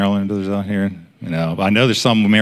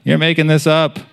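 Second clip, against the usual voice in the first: put-on, higher mocking voice; idiot's voice; 7 semitones higher than usual. The enemy nagging a praying believer, mocking idiot's voice. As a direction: mimic a foolish nagging voice. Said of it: "that idiot's voice saying".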